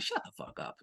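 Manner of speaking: an under-the-breath whispered dismissal; whispered scoff. Real, not acted. Vlogger scoffing at Hall's claim, whispered aside. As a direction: hiss it aside in disbelief.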